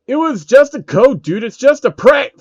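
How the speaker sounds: defensive yell. Acted. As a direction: shout your excuse. Phil defends himself before attacking.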